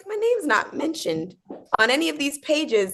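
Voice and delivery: high-pitched pretend shock; mock alarm. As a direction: squeak the discovery in mock dismay. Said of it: "being like".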